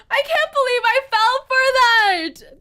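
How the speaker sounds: loud, high cry of disbelief; outraged disbelief. Real, not acted. Player was fooled by the game, outraged disbelief.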